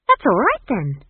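put-on high, bright-starting child's relief; relieved. A narrator's reading. Esk accepts Granny's argument, relieved. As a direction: jump in high, then settle. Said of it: "said Esk, relieved".

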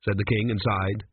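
ordinary delivery, nothing marked

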